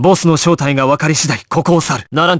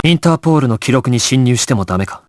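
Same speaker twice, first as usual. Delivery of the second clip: ordinary talk, nothing marked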